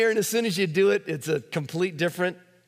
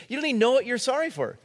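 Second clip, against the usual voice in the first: higher, fast mock of the wife's retort; exasperated wife; 9 semitones higher, faster than usual. Husband retelling his wife's retort in a fight, higher and fast. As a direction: rattle it off as the fed-up wife.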